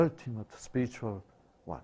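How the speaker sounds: ordinary talk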